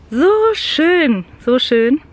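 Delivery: loud, high delight at the view. Real, not acted. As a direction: gush over the view.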